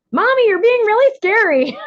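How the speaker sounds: put-on high, loud little-kid complaint; child's voice. Mother quoting her children calling her scary, high and loud. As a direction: squeak it up like a small child. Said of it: "press the button on me and go".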